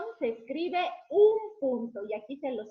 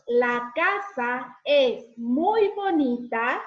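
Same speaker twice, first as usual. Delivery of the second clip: slow, louder, every word drawn out; slow model sentence; 8 dB louder, much slower than usual. Shown as a demonstration. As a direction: say it slowly and clearly for children. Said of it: "y decimos".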